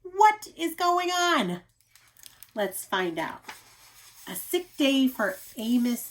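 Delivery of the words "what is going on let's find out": ordinary delivery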